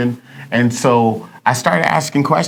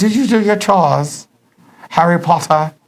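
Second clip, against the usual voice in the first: a hammed-up English accent, pitched higher; mock British accent; 4 semitones higher than usual. Husband mocking wife's chores question in a British accent. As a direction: tease her by putting on a posh English accent. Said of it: "I did not say it with an accent".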